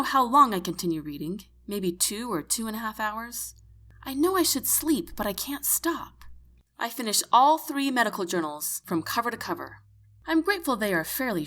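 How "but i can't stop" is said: usual speaking voice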